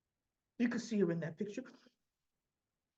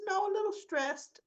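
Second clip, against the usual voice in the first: higher voiced Sam; 11 semitones higher than usual. Sam answering Christina.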